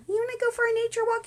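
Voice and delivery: very high cartoonish invitation; good friend voice. Good friend inviting you on a walk, high put-on voice. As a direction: ask it in a tiny put-on high voice.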